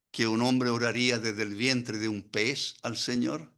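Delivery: usual speaking voice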